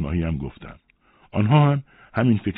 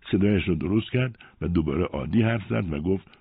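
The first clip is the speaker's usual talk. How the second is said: plain reading voice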